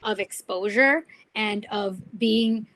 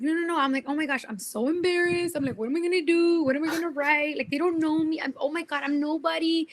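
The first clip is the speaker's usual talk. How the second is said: imitating her panicked younger self, higher and fretful; retold embarrassed panic; 7 semitones higher than usual. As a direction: replay the flustered rookie who can't believe she has fans. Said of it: "I'm embarrassed".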